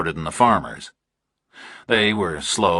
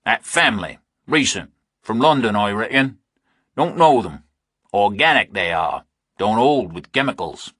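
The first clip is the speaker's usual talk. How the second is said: mock the stranger's question; rural farmer, scoffing; 3 semitones higher than usual. Narrator voices a rural farmer.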